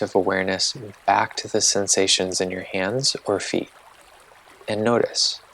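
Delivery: calm, unhurried meditation guide voice; guided meditation. Acted. Host guiding listeners through a body-sensation meditation, calm and unhurried.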